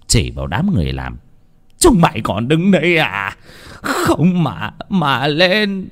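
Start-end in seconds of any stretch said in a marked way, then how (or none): 1.8-5.9 high, anguished furious order; enraged. Acted. Father ordering servants to take down his daughter, enraged. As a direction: cry out the order, drawing out the end. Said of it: "mắt long lên sòng sọc".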